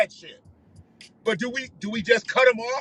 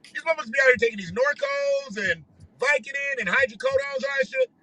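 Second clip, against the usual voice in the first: normal talking voice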